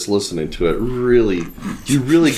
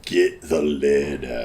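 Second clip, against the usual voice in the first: lower, slow, softer radio DJ voice; classic rock announcer; 7 semitones lower, 5 dB quieter, slower than usual. Shown as a demonstration. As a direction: croon it like a sleazy rock DJ. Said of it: "Isn't that how they do it".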